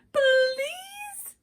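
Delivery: high pleading bird cry; begging bird. A narrator's reading. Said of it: "begged the bird".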